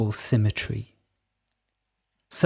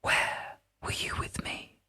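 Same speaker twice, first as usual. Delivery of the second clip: a softer, unseen spirit's whisper; whispering Pyrope; 10 dB quieter than usual. A narrator's reading. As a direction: breathe each word like a hidden voice.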